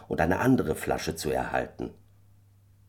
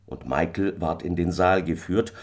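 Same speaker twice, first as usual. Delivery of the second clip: ordinary delivery, nothing marked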